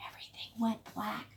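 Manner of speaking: hushed dramatic whisper. A narrator's reading. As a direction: drop to a breath. Dramatic hush.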